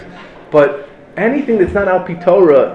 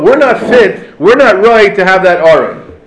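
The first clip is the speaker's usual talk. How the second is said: raised, loud mock protest; indignant protest; 4 semitones higher, 6 dB louder, slower than usual. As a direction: declare it like a man fed up.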